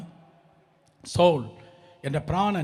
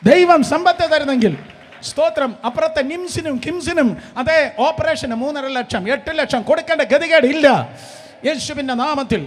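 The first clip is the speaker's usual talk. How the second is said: loud, high preaching at full force; ringing pulpit proclamation; fiery preaching; 8 semitones higher, 11 dB louder than usual. Acted.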